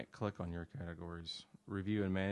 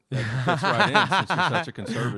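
loud, laughing tease; 5 semitones higher, 18 dB louder than usual. Real, not acted. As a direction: laugh through the jab. Teasing joke, laughing.

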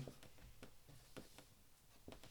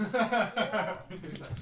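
laughing; 5 semitones higher, 7 dB louder than usual. Real, not acted. Laughing at the request.

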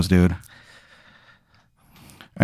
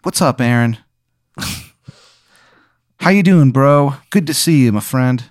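a hint of a higher, friendly dog; mildly higher bro dog voice; lightly voiced dog; 3 semitones higher than usual. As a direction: greet him like a chummy office dog. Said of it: "He goes".

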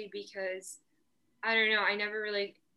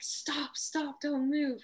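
higher, drawn-out warning cry; retold scream; 7 semitones higher, much slower than usual. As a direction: imitate a guide calling out an urgent warning. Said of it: "she screams over to us".

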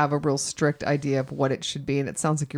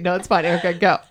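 ordinary delivery

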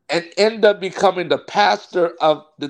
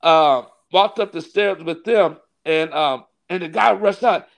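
normal talking voice

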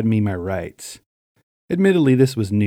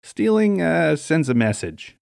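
higher, hesitant makeshift explanation; explaining; 6 semitones higher, slower than usual. A narrator's reading. Shoplifter improvises a justification to store employee, explaining. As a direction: reach for a reason as you go. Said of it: "I explained".

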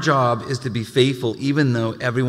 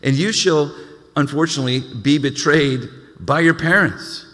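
lifted, louder reading of the verse; scripture reading; 4 semitones higher, 3 dB louder than usual. Acted. Pastor proclaiming scripture to the congregation, raised.

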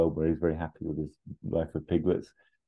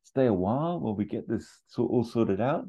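higher coaxing voice; pleading Arnett; 6 semitones higher than usual. Retold or imitated. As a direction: coax your way into staying. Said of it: "Arnett's just like".

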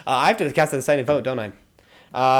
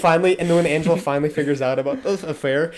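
usual speaking voice

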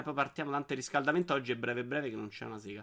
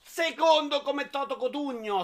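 mock showman voice; 11 semitones higher, 7 dB louder than usual. Mock singing announcer flourish.

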